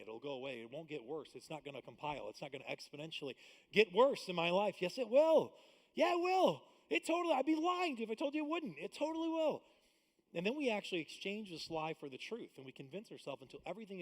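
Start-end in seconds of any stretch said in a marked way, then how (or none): 4.8-9.6 higher and louder, insisting; emphatic insistence. Real, not acted. Preacher insists to congregation that it will, loud. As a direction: hammer it home, yes it will.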